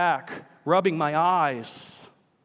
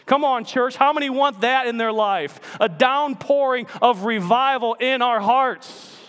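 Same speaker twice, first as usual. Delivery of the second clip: raised, fervent preaching voice; rally the church toward revival; 6 semitones higher, 5 dB louder than usual. Real, not acted. Pastor urging the congregation, fervent preaching.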